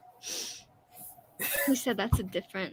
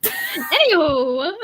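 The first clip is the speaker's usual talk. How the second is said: loud, very slow, higher, rising; drawn-out anywho; 4 semitones higher, 9 dB louder, much slower than usual. Pretend. Streamer moving the chat on, playfully drawn out. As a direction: stretch it out playfully, loud and lifted.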